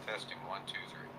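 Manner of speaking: faint, far-off mic test; testing through a distant microphone. Shown as a demonstration. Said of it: "We'll go to the Yeti directly".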